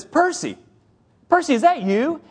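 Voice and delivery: high, eager call of a name from behind; calling out his name. Retold or imitated. Young man calling to his old mentor, high and eager. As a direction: call out to an old friend you just spotted.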